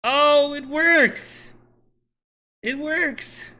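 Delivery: high-pitched happy exclamation; delighted surprise. Real, not acted. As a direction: cry out in amazed relief, high. Code suddenly works.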